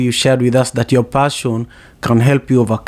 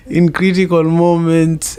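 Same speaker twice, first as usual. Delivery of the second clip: laughing while repeating; 5 semitones higher than usual. Real, not acted. Repeating his own punchline to the panel, laughing. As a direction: still laughing, voice pitched higher.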